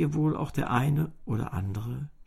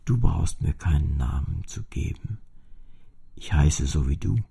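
low, hushed cello voice; a gentle old cello voice, mostly breathed; soft cello voice; 9 semitones lower than usual. A narrator's reading. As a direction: speak gently out of the strings. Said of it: "eine sanfte Stimme".

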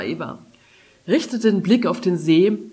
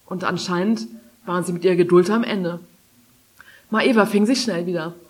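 usual speaking voice

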